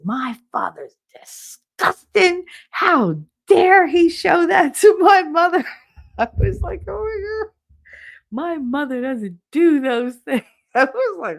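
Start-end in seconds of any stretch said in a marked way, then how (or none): 0.0-5.6 loud, high, drawn-out mock outrage; slow, shouted indignation, hammed up; mad. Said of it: "my son was mad". 6.9-10.4 high, whiny mock outrage; hammed-up wounded protest; scandalized son. Voicing son's outrage, mocking.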